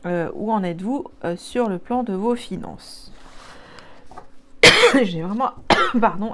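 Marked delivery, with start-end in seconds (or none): 3.2-5.0 loud high choking cough; choking on a drink. Real, not acted. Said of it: "avalé de travers". 5.0-6.3 quick words between choking coughs; choking on a drink. Real, not acted. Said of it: "avalé de travers".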